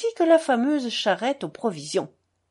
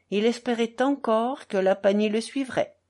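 ordinary delivery, nothing marked

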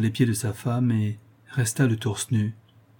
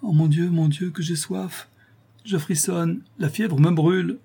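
high, plaintive moaning; feverish complaint; 7 semitones higher than usual. A narrator's reading. Sick wife burning with fever begs for water, plaintive. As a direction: lament in a much higher voice, softening on the thirst.

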